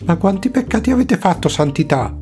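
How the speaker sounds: high, fast, scandalized friar; shocked question. Acted. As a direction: blurt out an astonished question.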